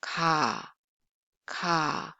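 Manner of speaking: plain Korean k in "ka"; soft lax k. Shown as a demonstration. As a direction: say "ka" low and relaxed, little puff. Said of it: "it's less air releasing than English K sound".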